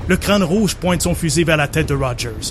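narrating an action scene over music; dramatic story narration. Acted. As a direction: tell it like a movie trailer. Narrates his version of scene.

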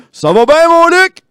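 loud, upbeat greeting; cheery bartender voice. Retold or imitated. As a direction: boom it out like an overjoyed regular. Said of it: "il est comme".

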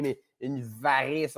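"une varice" dragged out slowly; slow savoring. Shown as a demonstration. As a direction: stretch out "varice" like a distasteful treat. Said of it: "tu peux pas le dire autrement que comme ça".

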